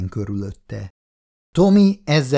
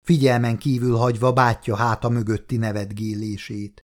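plain reading voice

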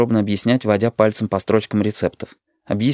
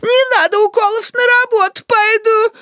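squealing grandma; 3 dB louder than usual. A narrator's reading. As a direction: panicky high granny wail. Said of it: "завизжала бабуля".

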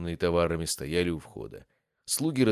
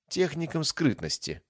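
proud; 10 semitones higher than usual. Acted. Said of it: "гордо ответила Кас".

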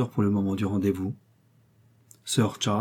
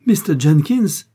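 loud, slow, higher cry of disbelief; exclaiming; 6 semitones higher, 8 dB louder, slower than usual. A narrator's reading. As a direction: blurt the name out in shock. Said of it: "s'écria Algernon".

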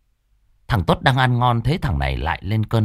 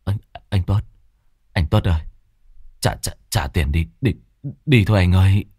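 stammering; 2 semitones lower, faster than usual. Acted. Mạnh stammering to Tuất to pay and leave, shaken. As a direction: stumble over the words, still shaken. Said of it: "Thằng Mạnh lắp bắp".

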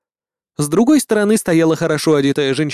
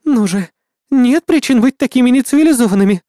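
indignant gnome lady; 8 semitones higher, 5 dB louder than usual. A narrator's reading.

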